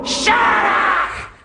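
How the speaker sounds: sudden loud snarl at the dog; angry shout. Acted. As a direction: bark it at the dog. Yells at the dog.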